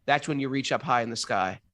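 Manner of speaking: usual speaking voice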